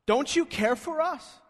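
high, pointed accusation on you; accusing. Retold or imitated. Disciples accusing Jesus of not caring, accusing. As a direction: make the question an outright accusation. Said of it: "is an accusation".

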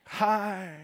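lightly voiced toddler greeting; a small child's shy hi.

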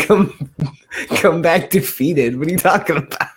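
ordinary talk, nothing marked

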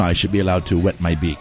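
soft, low mobster rasp; Godfather mobster. Retold or imitated. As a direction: quote the Don quietly. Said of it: "but he had that great voice".

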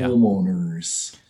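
Wisconsin long O. Retold or imitated. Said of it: "Say home".

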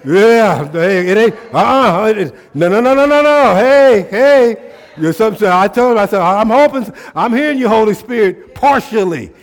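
loud, high, fired-up preaching; full-force preaching. Real, not acted. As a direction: preach it hard to the crowd, almost shouting.

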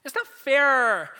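put-on high, loud whining teenager; high, loud mock-teen protest, slowing on "It's not fair"; whiny teen. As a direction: sulk about the phone in a shrill whine. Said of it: "how many times have you said".

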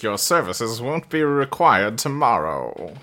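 low, slow put-on formal voice; cool formal dismissal. Man turning away the maid begging for information, mock-formal dismissal.

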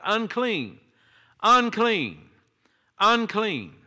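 loud, high calling out of a warning; yelling unclean. Retold or imitated. Leper calling out to warn the crowd, loud high cry. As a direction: shout it out so people clear the way. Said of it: "he would have to yell out".

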